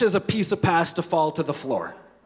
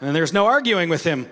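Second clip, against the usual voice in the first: normal talking voice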